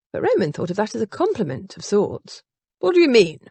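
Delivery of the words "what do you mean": higher, louder challenge; sharp demand for an explanation; challenging. A narrator's reading. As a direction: challenge her with a raised voice. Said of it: "he challenged his sister".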